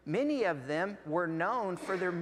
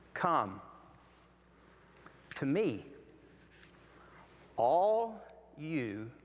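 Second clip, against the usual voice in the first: slow gentle reading; 3 semitones lower than usual. Shown as a demonstration. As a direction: read it gently so they can absorb it. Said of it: "Let me read it for you".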